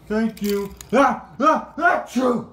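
high gasping build into sneeze. Acted.

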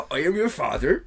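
loud, high-pitched West Country accent impression; mock villain in a rural West Country accent; David Prowse. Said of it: "that's what he sounded like".

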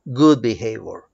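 ordinary delivery

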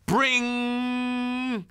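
high, ringing doorbell noise. Acted. Narrator mimicking the doorbell, high and ringing. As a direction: ring it out like a doorbell.

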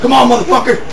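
ordinary talk

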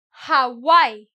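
Hawaii said with a clear J-like H at the start; H sounded. Shown as a demonstration. Teacher showing students a sounded H. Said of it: "I'm pronouncing the H".

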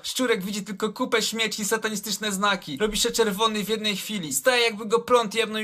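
ordinary delivery

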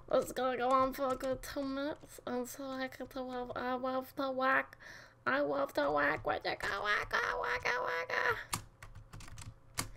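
goofy voice rising on each whack; put-on silly voice. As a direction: push the silly voice up into a squeak. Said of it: "I'm gonna talk like this".

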